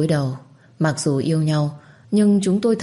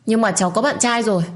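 higher, raised retort; snapping in his face; 6 semitones higher than usual. A narrator's reading. Girl snaps at the man standing before her, higher. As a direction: fire it straight at his face. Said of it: "quát vào mặt của anh ta".

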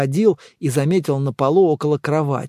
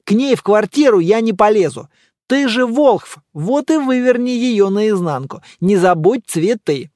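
higher-pitched, emphatic refusal; loud, lively higher character voice; firm, animated friend; 7 semitones higher, 5 dB louder than usual. Acted. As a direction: voice a friend digging in, pitch raised.

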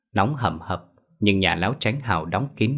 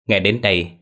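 ordinary delivery, nothing marked

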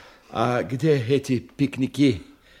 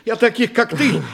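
much higher and louder, contemptuous; scornful shout; 14 semitones higher, 6 dB louder than usual. Acted. Boss berates subordinate.

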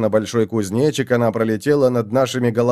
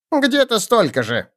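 goblin voice; 10 semitones higher than usual. A narrator's reading. Goblin answers.

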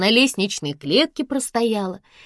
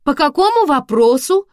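raised, louder polite question; polite inquiry; 9 semitones higher, 7 dB louder than usual. A narrator's reading. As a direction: ask courteously through a closed door. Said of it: "уточнила вежливо".

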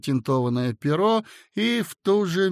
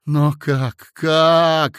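louder, rising cry; a sharp, astonished double question; ringing outburst; 7 dB louder, much slower than usual. A narrator's reading. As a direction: ring out in alarm. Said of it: "зазвенел Коротков".

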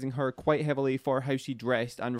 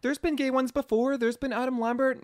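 high, mock-incredulous partner voice; imitating partner; 12 semitones higher than usual. Retelling to the host how his partner scoffed, in a high mock voice. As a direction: play the partner scoffing, pitched way up. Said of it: "and he said".

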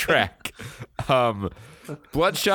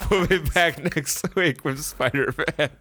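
laughing outro; 5 semitones higher than usual. Real, not acted. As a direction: wrap up while still laughing.

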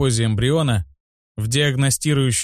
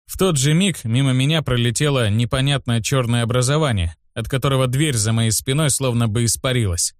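ordinary delivery, nothing marked

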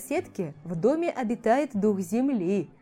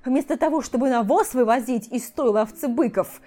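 loud, out-of-breath furious yelling; breathless shouting; 4 semitones higher, 9 dB louder than usual. A narrator's reading. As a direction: yell it, gasping with rage. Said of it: "задыхаясь, кричал эльф".

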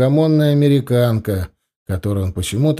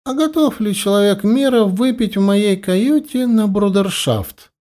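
flirtatious; 9 semitones higher than usual. Acted. Lady flirting, invites to cabin.

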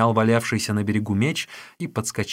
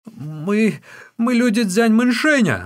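high, loud, stammering confession; panicked, pitched-up pleading voice; terrified captive; 12 semitones higher, 9 dB louder, slower than usual. A narrator's reading. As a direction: blurt it out in fear for your life. Terrified captive begs for life.

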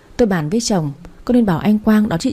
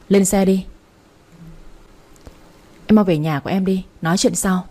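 ordinary delivery, nothing marked

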